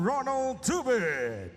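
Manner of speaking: ordinary talk, nothing marked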